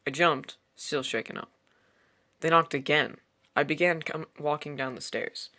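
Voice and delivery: ordinary delivery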